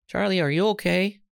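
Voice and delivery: put-on caring teacher asking the boy; teacher's gentle question. A narrator's reading. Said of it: "she asked".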